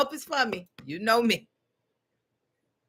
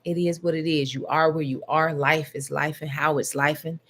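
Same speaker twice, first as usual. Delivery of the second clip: guided meditation; 6 semitones lower than usual. Acted. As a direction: ease them down gently into stillness. Leads a meditation.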